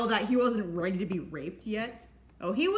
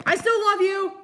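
love shout; 9 semitones higher, 7 dB louder than usual. Acted. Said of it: "thought I'd say that again".

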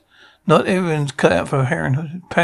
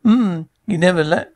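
normal talking voice

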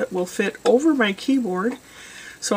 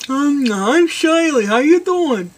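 gum-chewing truck stop waitress; chirpy waitress taking an order, slow; diner waitress; 5 semitones higher, 4 dB louder, slower than usual. Retold or imitated. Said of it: "sounds like a truck stop waitress that's chewing gum".

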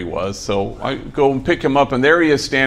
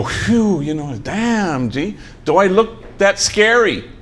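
a slow, higher, mock-relieved whine; relieved sigh; 8 semitones higher, slower than usual. Relieved stranger asking the homie, slow mock relief. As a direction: exhale it, then ask slowly. They get all calm.

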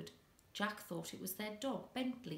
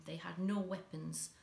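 ordinary delivery

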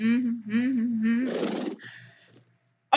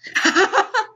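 short laugh; 10 semitones higher than usual. Real, not acted. Laughs at horse dying.

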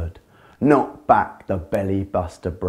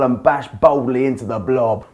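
plain reading voice

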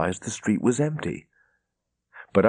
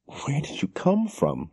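higher, slow, trembling disbelief; quivering voice; 7 semitones higher than usual. A narrator's reading. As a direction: let the words shake as if near tears. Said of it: "she said in a quivering voice".

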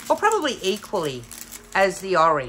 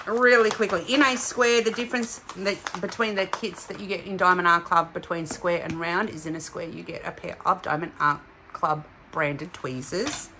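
ordinary talk, nothing marked

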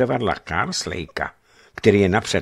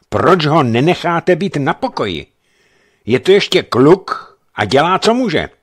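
raised, louder protest; indignant defence, raised voice; heated protest; 8 semitones higher, 7 dB louder than usual. A narrator's reading. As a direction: push back hard for the boy. Heated argument with officer.

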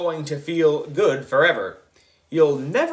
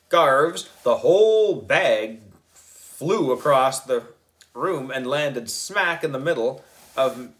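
ordinary delivery

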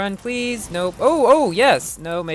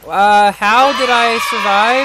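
louder and slow, astonished; surprised disbelief; 2 semitones higher, 4 dB louder, much slower than usual. Real, not acted. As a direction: marvel that you made it through. Surviving a crash in race.

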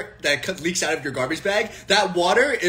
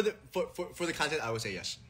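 trailing off much softer, half to himself; quiet aside; 4 semitones lower, 15 dB quieter than usual. Real, not acted. Streamer confiding to chat, much softer aside. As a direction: drop it to a murmured aside.

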